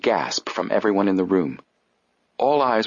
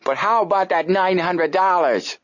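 suspicious demand; 11 semitones higher than usual. Acted. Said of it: "demanded suspiciously".

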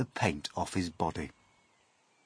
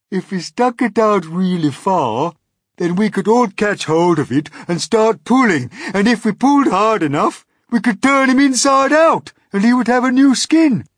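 louder, fast, odd-voiced worm; earthworm's gleeful voice; 13 semitones higher, 11 dB louder than usual. Earthworm describing the centipede statue, fast and loud. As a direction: rattle on with gloomy glee.

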